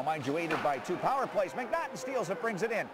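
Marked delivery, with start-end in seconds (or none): 1.5-2.9 goal yell. Real, not acted. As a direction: build and blast scores. Goal call.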